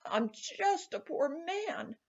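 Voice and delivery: high, slow whining plea; the leprechaun whining to be let go; whined. A narrator's reading. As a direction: whine it like a pitiful little man. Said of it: "wiggled and twisted and whined".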